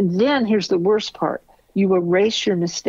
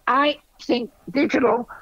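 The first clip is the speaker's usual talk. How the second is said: ordinary delivery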